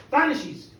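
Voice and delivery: ordinary delivery, nothing marked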